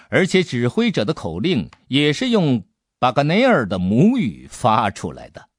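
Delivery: ordinary delivery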